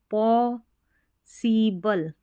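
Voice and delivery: "Possible" slowly as paw, see, bul; syllable by syllable. Shown as a demonstration. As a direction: break "possible" into drawn-out parts. Teacher sounds out the new word. ("Possible" को धीरे-धीरे तोड़कर)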